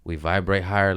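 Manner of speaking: usual speaking voice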